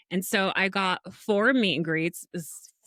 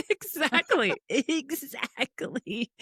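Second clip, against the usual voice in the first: high, laughing agreement, second word drawn out; 5 semitones higher, much slower than usual. Real, not acted. Guest agrees with host's reaction, laughing.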